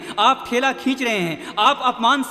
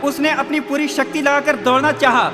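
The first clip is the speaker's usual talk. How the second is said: urgent, driving race narration at full force; impassioned preaching; 3 semitones higher, 5 dB louder than usual. A narrator's reading. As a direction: build the race like a rousing preacher. (जोशीला, ज़ोरदार उपदेश)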